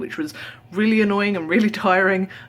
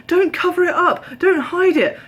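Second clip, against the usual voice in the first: mock pushy saleswoman; 10 semitones higher, 4 dB louder than usual. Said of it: "she's like".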